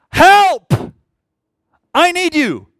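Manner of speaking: high, loud cry to God; pleading at full voice; urgent prayer. Acted. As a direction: shout out desperately for help. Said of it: "It's this sense of urgency".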